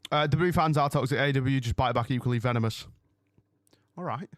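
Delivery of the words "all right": whispered all right; hushed aside to chat. Real, not acted. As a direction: breathe it out under the breath. Reacts to chat comment.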